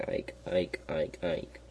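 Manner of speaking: soft, understated frog croak; frogs' cry. A narrator's reading. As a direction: let the frog call drop low and quiet. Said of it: "But the frogs still cried".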